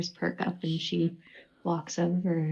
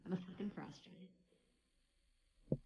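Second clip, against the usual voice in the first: growling to herself; 18 dB quieter, slower than usual. Acted. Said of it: "growling at herself".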